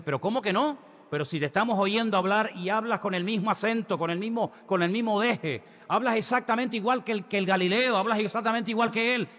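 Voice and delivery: put-on insistent girl. Servant girl insists Peter talks like a Galilean, high and insistent. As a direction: press the accusation, won't let it go. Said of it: "la chica vuelve a decir".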